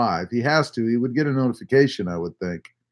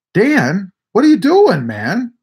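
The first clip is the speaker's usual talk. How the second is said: high, loud mock outrage; mock exasperated; 6 semitones higher, 6 dB louder, slower than usual. Real, not acted. Streamer telling off Dan over the Santa joke, mock outrage. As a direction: scold a friend half-jokingly.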